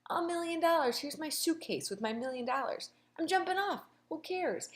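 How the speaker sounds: high, giddy mock bravado; carefree Cole. Cole jumping off the cliff, high and giddy. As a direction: toss it off giddily, up high. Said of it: "he's like".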